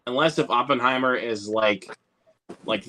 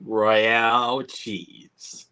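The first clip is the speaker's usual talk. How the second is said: extremely slow delivery; slow, lingering punchline; drawn-out movie quote; 4 semitones lower, much slower than usual. Acted. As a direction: draw both words right out. Delivering the film punchline again.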